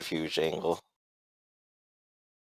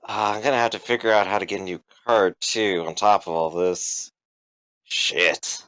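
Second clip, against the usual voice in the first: mild sigh, sinking lower to the end; slight sigh; 4 semitones lower than usual. Acted. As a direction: grumble to yourself with a small sigh. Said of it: "he just kind of sighs a little bit".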